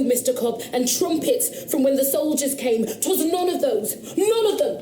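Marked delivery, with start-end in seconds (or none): 2.9-4.8 rising, higher and higher in protest; insistent denial. Acted. Witness refusing the magistrate's explanation, insistent and rising. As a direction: push back hard, voice climbing.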